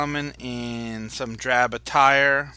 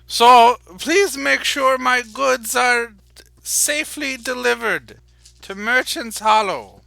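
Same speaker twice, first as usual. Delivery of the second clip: higher and much louder, a showy send-off; booming merchant act; 11 semitones higher, 10 dB louder than usual. Acted. Merchant loudly sends the guards off with his goods, high and booming. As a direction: put the loud public merchant act back on. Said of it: "he kinda changes his stance again".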